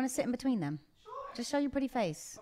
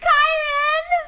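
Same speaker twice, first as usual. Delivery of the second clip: high, loud excited shout of a name; excited call; 10 dB louder than usual. Real, not acted. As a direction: call him over with delight. Calling the dog over, excited.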